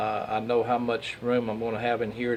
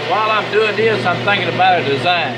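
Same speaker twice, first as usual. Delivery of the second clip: loud and higher, over machinery; raised voice over noise; 4 semitones higher, 4 dB louder than usual. Real, not acted. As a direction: project over a running lathe. Talking over the running lathe.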